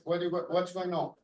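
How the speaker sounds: ordinary delivery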